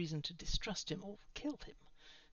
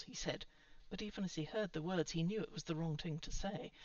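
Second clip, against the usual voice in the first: usual speaking voice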